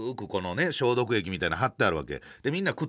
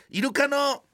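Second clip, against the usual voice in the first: high, bright-pitched classroom quiz voice; peppy instructor voice; 4 dB louder than usual. Retold or imitated. Instructor quizzing shy tourists, hammed-up high and peppy. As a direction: play the overeager dolphin instructor asking the class. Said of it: "みたいなテンションで向こうのインストラクターやってんねんけど".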